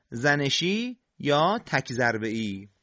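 usual speaking voice

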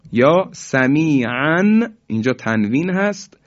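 ordinary talk, nothing marked

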